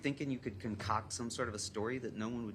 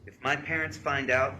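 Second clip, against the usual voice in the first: loud, raised-pitch read-aloud; higher, punched-up reading of a text; emphatic reading; 5 semitones higher, 11 dB louder than usual. Retold or imitated. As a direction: read the worried text out forcefully. Reads girl's frantic texts aloud.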